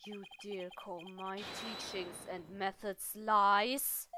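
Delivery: low, soft, slow menace; quietly threatening professor; seething fury. Acted. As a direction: hold the rage down, slow and low. Professor seething before exploding.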